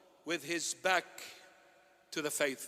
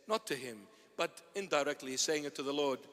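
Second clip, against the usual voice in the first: ordinary talk, nothing marked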